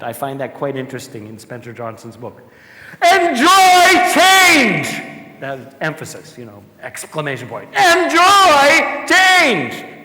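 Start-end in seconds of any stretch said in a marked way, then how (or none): 3.0-5.0 high, slow, loud shout. Shown as a demonstration. Speaker shouts book's rule at audience, loud and slow. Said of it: "You're not enjoying me shouting at you". 7.7-10.0 high, slow, loud shout. Shown as a demonstration. Speaker shouts book's rule at audience, loud and slow. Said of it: "You're not enjoying me shouting at you".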